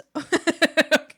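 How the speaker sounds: loud burst of laughter; laughing. Real, not acted. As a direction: burst out laughing at your own mistake. Laughs at own drawing.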